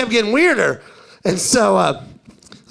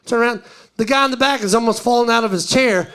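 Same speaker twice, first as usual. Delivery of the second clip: ordinary talk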